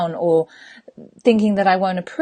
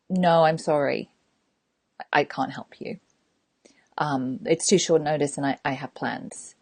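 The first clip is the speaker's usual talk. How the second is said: low, soft, measured no; calm refusal; 4 semitones lower, 5 dB quieter than usual. Acted. Coach honestly turning down a friend's request, calm refusal. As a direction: decline gently and quietly.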